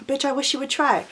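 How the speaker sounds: soft, cool challenge; same dare, tossed off softly at normal pitch; understated dare. Retold or imitated. Repeats the comeback, toned down.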